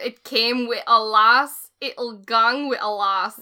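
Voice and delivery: put-on slow, drawn-out Scottish accent, loud. Co-host reads the dying king's last words, Scottish accent. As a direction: deliver it slowly in a broad Scottish accent. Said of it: "said with a Scottish accent".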